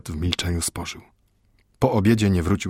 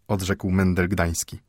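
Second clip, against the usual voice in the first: plain reading voice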